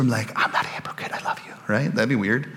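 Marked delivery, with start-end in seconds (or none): 0.3-1.5 unvoiced confiding whisper, very soft. Preacher mimics hugging people to reassure them, whispering. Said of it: "hug them and whisper".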